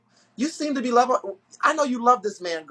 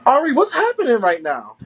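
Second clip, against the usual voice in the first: louder, raised-pitch call to someone off mic; 3 semitones higher, 7 dB louder than usual. Real, not acted. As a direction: call out for an answer amid commotion. Calling to Ari during off-mic commotion.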